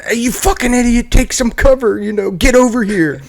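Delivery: high-pitched mock yell; retold yelling. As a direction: imitate a buddy yelling for someone to take cover. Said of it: "start yelling at".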